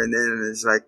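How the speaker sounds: normal talking voice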